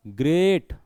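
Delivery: Great with a firm, lengthened vowel; stressed, drawn-out great; stressed long sound. Shown as a demonstration. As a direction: lean on great and hold its vowel. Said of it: "लंबा sound आ रहा है".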